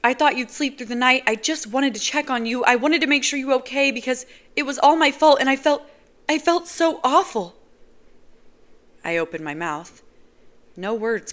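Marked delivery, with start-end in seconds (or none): 0.0-7.6 higher, louder distressed woman; upset Nazira. Acted. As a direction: blurt it out anxiously.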